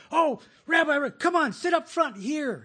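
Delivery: high-pitched, eager call to an honored guest; loud, high, flattering greeting; fawning welcome. Acted. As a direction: gush as you usher them to the front. Acting out people fawning over rabbi.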